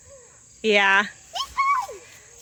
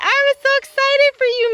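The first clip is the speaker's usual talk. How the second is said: much higher, louder squeal of excitement; excited pet talk; 12 semitones higher, 3 dB louder than usual. Real, not acted. Farmer cheering the goats meeting Max, high excited squeal.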